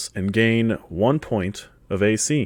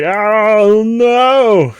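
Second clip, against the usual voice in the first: high, loud "oh no" wail; hammed-up alarm at tech trouble; mock panic; 10 semitones higher, 7 dB louder than usual. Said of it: "everybody else is like".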